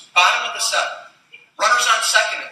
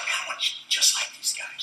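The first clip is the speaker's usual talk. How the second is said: hushed inner voice, no voice at all; awed private whisper; whispered thought; 9 dB quieter than usual. Retold or imitated. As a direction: whisper it to yourself in awe.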